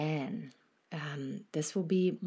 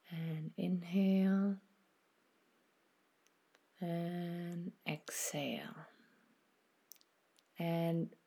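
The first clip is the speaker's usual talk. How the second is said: guided meditation; 4 dB quieter than usual. Acted. Host guiding listeners through a body scan, soft meditation voice. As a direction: ease the listener into stillness, unhurried and gentle.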